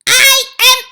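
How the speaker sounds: very high, loud, furious screech; the Grand High Witch rages at her coven over the children; screaming witch. Acted. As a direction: shriek with disgust at the children. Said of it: "she screamed".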